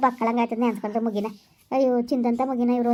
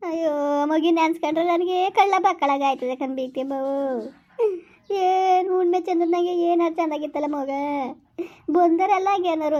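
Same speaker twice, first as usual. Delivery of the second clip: slow wail, climbing in pitch; tearful lament; 2 semitones higher than usual. Acted. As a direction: mourn the baby through tears. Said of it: "ಅವೇನೋ ಅಳ್ತದೆ".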